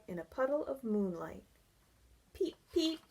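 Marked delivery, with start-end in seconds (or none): 2.3-3.0 high tiny peep; frog call. Acted. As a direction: chirp like a little frog. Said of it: "the frog called".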